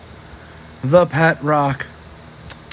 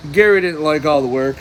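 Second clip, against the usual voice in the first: usual speaking voice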